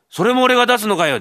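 high, loud incredulous cry; can't believe he's paying that too; exasperated outburst. Acted. Thief is exasperated at the demand. (呆れて声を裏返す)

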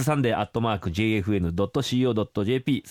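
fast announcer readout; quick radio address read; brisk address read. Shown as a demonstration. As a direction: rattle off the email address quickly. Reading the email address.

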